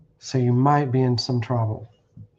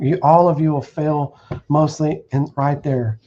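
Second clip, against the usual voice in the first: ordinary delivery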